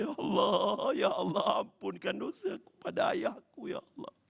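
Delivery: acted tearful plea climbing very high; weeping prayer. Son begs God's forgiveness over his father, weeping. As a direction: sob out a plea, breaking up high. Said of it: "dia terus menangis".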